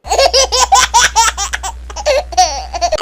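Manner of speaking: light fond laughter; amused laugh. Acted. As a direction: laugh at the naughty boy. Tete laughs at mischief. (प्यार भरी हँसी)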